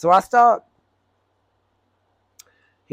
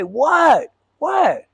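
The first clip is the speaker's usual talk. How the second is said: higher, rising disbelief; confused, pitched-up what; baffled what; 10 semitones higher, 4 dB louder than usual. Real, not acted. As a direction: driver can't believe it. Driver baffled by the banging.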